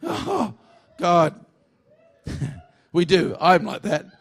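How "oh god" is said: mock dismay. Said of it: "We feel like that every time".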